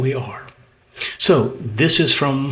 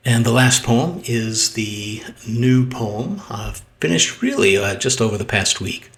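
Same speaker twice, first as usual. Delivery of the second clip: usual speaking voice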